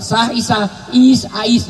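high playful call by nickname; calling a wife. Retold or imitated. Husband calling Aisyah by nickname, high mock call. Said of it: "nggak pernah manggil".

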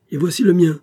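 match his threat, word for word; ringing counter-challenge; defiant retort. A narrator's reading. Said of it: "riposta Ben Radel en l'imitant".

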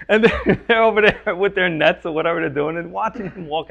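amused laughing narration. Real, not acted. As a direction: chuckle as you picture the fishermen watching. Laughing while imagining the scene.

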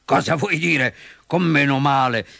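indignant fox voice; put-on villain's outrage. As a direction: demand an explanation, affronted. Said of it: "fece la volpe indignata".

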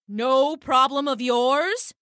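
slow, affronted rising question; incredulous dove. Acted. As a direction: echo "No problem of yours" in slow disbelief. Dove's first incredulous echo.

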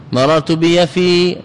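بيفي with the restored ī held long; slow down and stretch the end of بيفي; final long ي. Shown as a demonstration. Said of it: "ترد الياء واجب".

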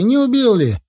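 high, loud panicked mother's cry; mother screaming in panic at the fight; hysterical cry. Acted. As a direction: shriek it out in terror for her son. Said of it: "кричала истерически".

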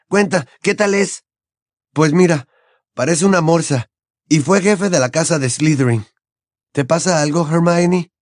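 lightly voiced young friends; teen character voices. Characters chatting in dialogue.

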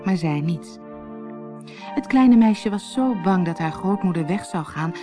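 usual speaking voice

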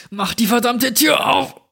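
high, frantic shout with the last strength; desperate scream. A narrator's reading. Trapped Jack screams at Steve to open the door, desperate yell. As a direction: scream it as if your life depends on it. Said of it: "schrie mit all meiner verbliebenen Kraft".

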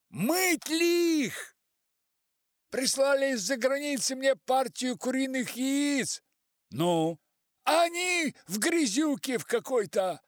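high, very loud, insistent; shouting phone caller. A narrator's reading. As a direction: yell every word down a bad line. Caller shouting down bad line.

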